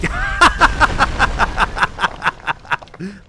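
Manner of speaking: long, loud, high laughing fit; high, loud run of cackles; scornful laugh. Real, not acted. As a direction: laugh hard at a ridiculous claim. Laughs at claim he calls bullshit.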